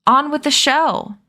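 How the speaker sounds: an understated shout from the crowd; mild crowd shout. A narrator's reading. Impatient crowd calling for the show to start, mild shout. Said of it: "People began to shout".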